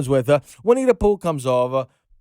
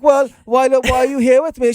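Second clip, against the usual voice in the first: high, louder British imitation; mock British accent; 8 semitones higher, 4 dB louder than usual. Christopher Robin asking his girlfriend why she came, mock British accent. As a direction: imitate a British man, pitched well up. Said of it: "Oh, the British accent?".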